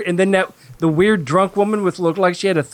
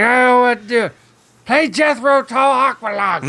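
fake drunk voice; 5 semitones higher than usual. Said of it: "your fake drunk voice sound like your Harry Carey impression".

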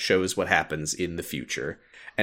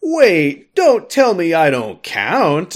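higher, louder, slowed pleading outcry; voiced character protest; 8 semitones higher, 7 dB louder, slower than usual. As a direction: throw it out as a wounded demand to be counted.

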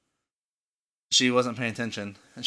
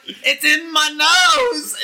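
high, loud, stretched-out mock distress; hammed-up horrified cry; imitated panicked wail; 8 dB louder, much slower than usual. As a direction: wail as if the stink won't leave. Imitating friend overcome by the stink.

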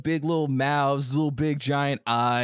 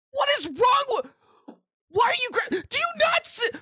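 shrieking panicked woman; faster than usual. Acted. GM voicing a grabbed woman shrieking at her attacker in panic.

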